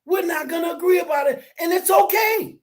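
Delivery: loud and high, fervent; emphatic. Real, not acted. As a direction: hammer the point home to Brother Dupree. Emphatic point to partner.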